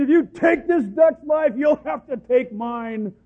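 slow, loud protester cry; hammed-up protester yelling slowly; mock protest shout. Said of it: "people chained to the street".

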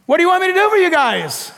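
high, rapid mock query; comic calling question. Jesus asking two blind men, comic high question. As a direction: fire it off high and playful.

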